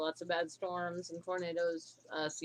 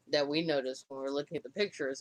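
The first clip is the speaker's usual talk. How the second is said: ordinary talk, nothing marked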